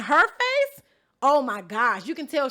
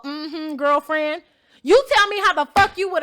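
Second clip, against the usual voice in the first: sassy mimicry; 3 semitones higher, 6 dB louder than usual. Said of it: "hand on his hip, rolls his neck, snaps his finger".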